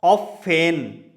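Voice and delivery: ऑफेन said slowly with an open o; teacher demonstrating German ऑफेन slowly; slow German pronunciation. Shown as a demonstration. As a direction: draw out ऑफेन like German offen. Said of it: "उच्च German में जाएंगे".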